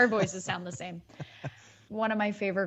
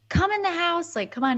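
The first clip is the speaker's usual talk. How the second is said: lightly voiced New York kid, higher; a hint of a New York kid calling; New York accent; 9 semitones higher, 3 dB louder, faster than usual. Kid called inside in a light New York accent. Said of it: "New York accent".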